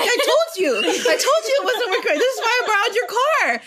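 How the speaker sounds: high, half-laughing mock protest; exasperated retort. Daughter to dad about her dead car, mock exasperated.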